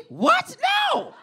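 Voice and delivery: high, loud shout of disbelief; outraged shout. Pretend. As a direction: yell it in shock.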